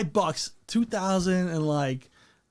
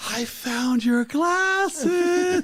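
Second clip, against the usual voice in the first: high, slow, gasping delight; gasping mock discovery; 8 semitones higher, much slower than usual. Friend retelling a silly game, gasping high delight. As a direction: gasp as if you just found them. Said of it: "I'm like".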